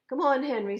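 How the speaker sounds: loud, high call. A narrator's reading. Thomas calling Henry to follow him, loud. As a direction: shout the invitation across the bridge.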